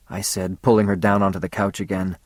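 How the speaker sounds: ordinary delivery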